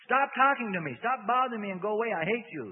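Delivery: high, loud angry outburst; angry demon. A narrator's reading. Demon tells Bob to go away, angry shouting. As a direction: lash out to drive him off.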